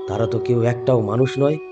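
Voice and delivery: ordinary delivery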